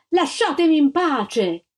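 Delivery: desperate shout. A narrator's reading. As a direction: cry out to be left alone.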